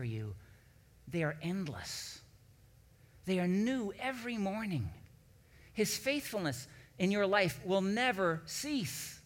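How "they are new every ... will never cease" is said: higher and louder, building to a ringing proclamation; preaching at full force. Real, not acted. Pastor to congregation, proclaiming God's faithfulness, building preaching. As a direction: preach it with growing fervor, climbing each line.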